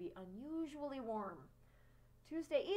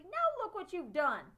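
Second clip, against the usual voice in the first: loud, cross outburst at a friend; boy scolding his friend crossly; 6 semitones higher, 10 dB louder than usual. A narrator's reading. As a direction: snap it out, higher and louder. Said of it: "Then he said crossly".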